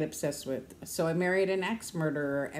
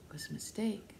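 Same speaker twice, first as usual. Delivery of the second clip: whisper; 8 dB quieter than usual. Real, not acted. Whispered aside.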